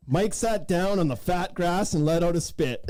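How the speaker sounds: ordinary talk, nothing marked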